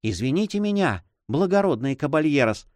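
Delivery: mildly flustered, deferential; timid babble. A narrator's reading. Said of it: "пролепетал монах".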